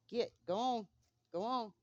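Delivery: loud high shoo; shooing animals. Real, not acted. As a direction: shoo them away loudly. Shooing chickens.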